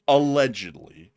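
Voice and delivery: Allegedly drawn out slow and loud; slow emphatic allegedly. Shown as a demonstration. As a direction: say Allegedly slowly, each syllable weighted. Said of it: "That's the magic word".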